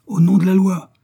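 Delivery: loud, high official summons; shouted command. A narrator's reading. Commissioner outside the door with agents, shouting. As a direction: proclaim it at full voice. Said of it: "cria le commissaire".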